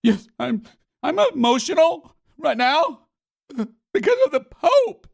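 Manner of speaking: high, loud, whiny mock sobbing; squeaky fake-tearful parody of the official; mock crying. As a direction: ham up a blubbering imitation of her. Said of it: "Did you hear what she said?".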